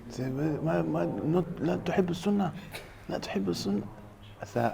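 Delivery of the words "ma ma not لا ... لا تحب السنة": high-pitched mock objection; higher-pitched imitation of an insistent worshipper; retold French brother. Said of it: "the French brother".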